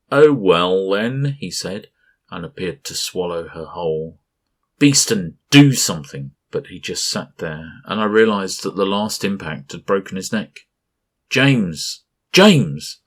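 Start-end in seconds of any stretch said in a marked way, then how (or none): 0.1-1.4 loud, very slow, mock-indifferent creature; slow mock-shrug. A narrator's reading. Creature shrugs off Lees' refusal, slow and indifferent. Creature before swallowing Lees. 4.8-6.4 loud, urgent shout; desperate shout. A narrator's reading. Captain desperately shouts at pilot Beeston. 11.3-13.0 high, loud desperate calls; calling out. A narrator's reading. Captain calls out to engineer James, desperate.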